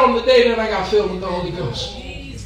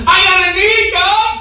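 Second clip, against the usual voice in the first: higher, louder cry to God; 6 semitones higher, 6 dB louder than usual. Acted. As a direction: call it out loud and high, pleading. Prayer cry said two ways.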